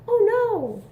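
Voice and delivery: high surprised cry; mock alarm for the kids. Button pops off in story.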